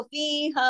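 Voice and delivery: फीहा with each vowel held, ending at a pause; stopping length. Shown as a demonstration. Teacher shows stopping on the word, lengthened फीहा. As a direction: draw out फीहा and stop. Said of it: "अगर रुकेंगे तो".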